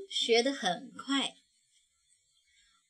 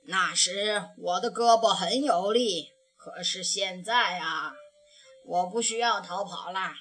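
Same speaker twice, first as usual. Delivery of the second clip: put-on lower, steady voice of a wise old bear; old grandpa bear; 4 semitones lower, 3 dB louder than usual. A narrator's reading. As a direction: speak like a calm old grandfather. (熊爷爷对小熊慢慢说，低沉温和)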